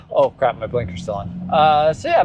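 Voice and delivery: ordinary talk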